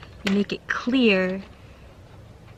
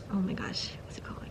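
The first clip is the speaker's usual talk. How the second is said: whispered aside; 12 dB quieter, faster than usual. Real, not acted. Reader breaks off to herself, whispering while searching for a word.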